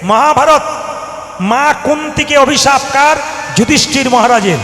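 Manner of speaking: high, loud preacher call; a preacher's loud call to the hall. Real, not acted. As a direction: shout it to the whole crowd. Preacher calling out to crowd.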